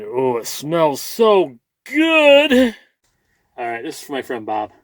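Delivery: ordinary talk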